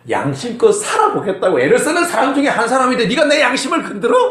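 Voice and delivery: high-pitched indignant challenge; retold outrage. Pastor reacting to an attack on his conscience, retold outrage. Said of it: "내가 뚜껑이 열린 거야".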